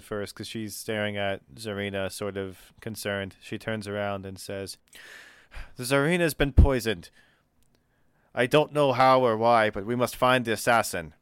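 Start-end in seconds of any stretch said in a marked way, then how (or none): 5.8-11.1 a noble announcing a poisoning, raised and urgent; urgent announcement. Acted. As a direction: sound the alarm to the crew.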